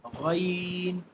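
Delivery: normal talking voice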